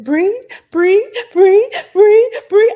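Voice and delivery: high, loud shouted chant; shouting breathe. Retold or imitated. Little girl imitating breathing advice, loud high shout. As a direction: yell it like an excited toddler. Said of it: "but not like that".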